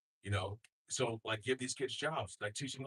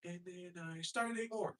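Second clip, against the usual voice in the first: slow, softer, higher mock Trump; Trump impression; 9 semitones higher, 9 dB quieter, slower than usual. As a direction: drag it out like a boastful Trump. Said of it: "He's like".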